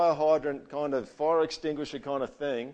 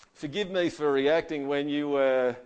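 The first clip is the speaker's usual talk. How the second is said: backhanded apology; 2 semitones higher, 5 dB louder, slower than usual. Retold or imitated. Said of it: "this one's a classic one".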